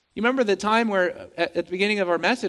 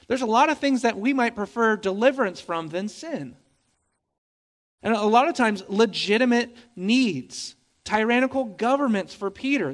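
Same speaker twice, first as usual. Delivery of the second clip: ordinary delivery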